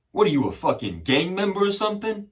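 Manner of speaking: high, loud imitation of a hostile officer; accusing cop. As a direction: throw it out as a mock cop's accusation. Cop accuses him in retold story.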